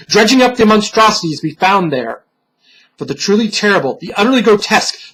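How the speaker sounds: plain reading voice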